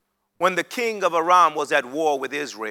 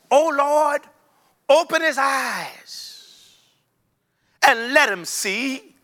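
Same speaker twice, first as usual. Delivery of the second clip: higher, slow, reverent plea; pleading prayer; 8 semitones higher, slower than usual. Acted. Elisha prays for the servant's eyes, high and slow.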